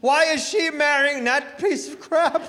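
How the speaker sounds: high, loud, slow teary mock wail; bawling mother yelling. Mother in the pews at the wedding, hammed-up teary yell. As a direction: wail it like a weeping mom. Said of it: "hearing her yelling".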